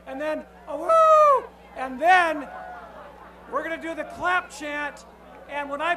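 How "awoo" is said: high, loud wolf howl. Shown as a demonstration. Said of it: "take your fingers like this and howl".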